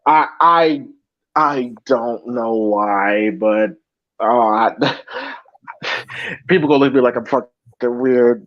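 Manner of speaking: low and halting, fighting back tears; real tearful stammer; choked up. Real, not acted. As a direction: try to explain while choking up. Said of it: "the sound of you being choked up".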